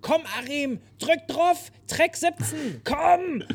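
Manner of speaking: hoch gerufene Anweisung an den Tontechniker; quer über die Menge gebrüllt. Retold or imitated. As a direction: ruf über die ganze Menge nach hinten. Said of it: "hat sie so quer über die Menge gebrüllt". (Sängerin ruft dem Tontechniker hoch und laut zu)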